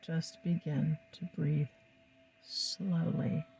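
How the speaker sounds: calm, soothing hypnotic guidance; hypnotist. Acted. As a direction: lull the listener into trance. Leads a hypnosis session over music.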